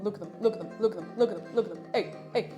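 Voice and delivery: usual speaking voice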